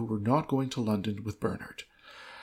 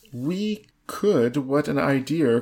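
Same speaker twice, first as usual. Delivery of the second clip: loud, eager exclamation, raised pitch; cried out; 3 semitones higher, 6 dB louder, slower than usual. Acted. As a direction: burst out with the idea, thrilled. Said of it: "cried Ethel".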